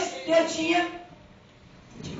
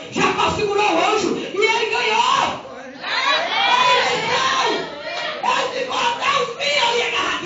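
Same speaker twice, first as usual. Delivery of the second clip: shouted preaching; 8 semitones higher than usual. Acted. Preacher telling the church of Jacob holding the angel, shouting. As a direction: proclaim Jacob's victory at full force.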